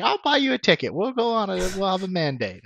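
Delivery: high, coaxing friend impression; Chris. Friend offering roommate a movie outing, high mock voice. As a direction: pitch it way up, easing down at the end. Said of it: "Chris Kavanaugh actually sounded like this".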